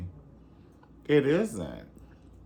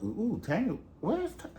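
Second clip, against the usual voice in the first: mock puzzled calling; 11 semitones higher, 3 dB quieter than usual. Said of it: "they was like".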